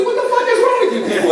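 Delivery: high, fast, laughing mock outrage. Real, not acted. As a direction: scold the crowd with a laugh. Comic scolds silent audience.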